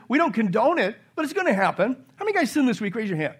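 usual speaking voice

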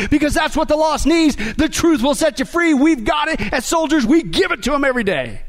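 relentless, impassioned exhortation; fired-up preaching. Real, not acted. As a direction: preach it like rousing the troops. Rousing battle cry to congregation.

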